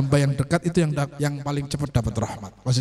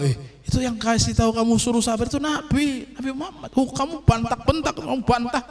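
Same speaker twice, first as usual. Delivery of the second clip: imitated reproach; 8 semitones higher than usual. Bystander tells off the woman.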